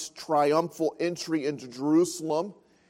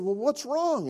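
worried parent; 7 semitones higher than usual. Retold or imitated. Parents ask the crying boy.

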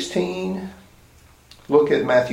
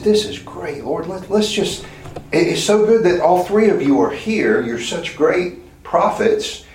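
higher, gushing, overeager disciple; excited Peter; 7 semitones higher than usual. Pretend. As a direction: Peter carried away, beaming at the three prophets.

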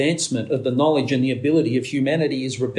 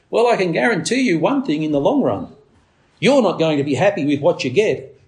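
ordinary delivery

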